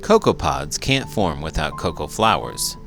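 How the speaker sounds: ordinary delivery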